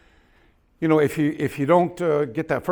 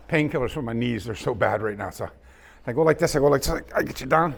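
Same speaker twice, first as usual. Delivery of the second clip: usual speaking voice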